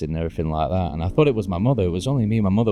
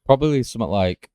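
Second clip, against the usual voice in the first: ordinary delivery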